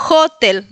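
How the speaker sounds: "hotel" stressed on HO, h like j; first-syllable stress. Shown as a demonstration. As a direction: say "hotel" as JÓ-tel. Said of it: "poner el acento en la primera sílaba". ("hotel" con acento en "ho")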